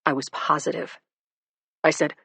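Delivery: usual speaking voice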